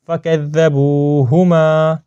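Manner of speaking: slow, drawn-out Quranic recitation. Shown as a demonstration. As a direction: recite slowly with long held vowels. (ٹھہر ٹھہر کر تلاوت)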